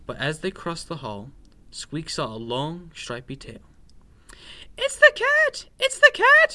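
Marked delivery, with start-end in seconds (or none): 4.8-6.5 very high, loud mouse shriek; panicked cry. A narrator's reading. Scared mouse spots a stripy tail, shrieking in panic. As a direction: squeal the alarm at the top of your voice. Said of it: "he cried".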